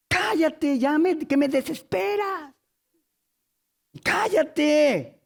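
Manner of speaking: high, drawn-out exasperated 'shut up'; acted exasperation. Preacher acts out someone fed up with complaints, exasperated.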